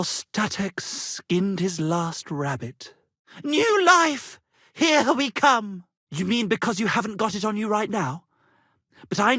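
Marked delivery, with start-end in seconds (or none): 3.4-5.8 loud, high cry of joy; excited cheer. Acted. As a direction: throw it out like a triumphant toast. Minstrels cheering a new start.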